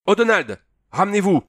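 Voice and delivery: high, loud military summons; officer's urgent shout; calling out. A narrator's reading. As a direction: yell for your man to come. Colonel calls his man over.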